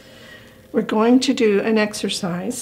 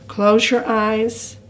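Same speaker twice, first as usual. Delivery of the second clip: slow, calm script reading with long pauses; calm guided imagery. Shown as a demonstration. Leading older adults to imagine the lake, calm and slow. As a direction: read slowly, growing softer toward the end. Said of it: "with a calm, soft voice. Go slower and softer".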